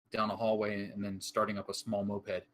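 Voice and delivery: ordinary delivery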